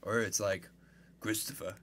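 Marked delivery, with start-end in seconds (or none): 1.2-1.8 softly put-on Italian-American mobster; a hint of Sopranos-style Italian-American; Italian-American accent. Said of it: "You watch the entire Sopranos".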